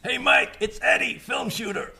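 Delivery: high, hammed-up shouted greeting; Michael Russo style. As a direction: call it out high and throaty. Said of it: "it hurts my throat".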